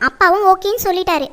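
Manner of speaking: ordinary delivery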